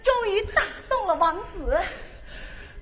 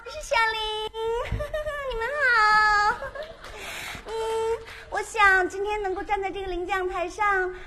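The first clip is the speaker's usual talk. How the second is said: put-on baby voice; 3 semitones higher than usual. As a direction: gush like a giggly pageant winner. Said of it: "我的娃娃音". (娃娃音)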